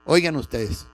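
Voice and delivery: ordinary talk, nothing marked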